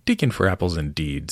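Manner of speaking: plain reading voice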